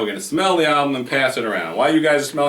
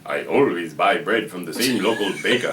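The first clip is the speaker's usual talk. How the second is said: put-on Russian accent; 6 semitones lower than usual. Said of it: "My Russian accent sounds like Dracula".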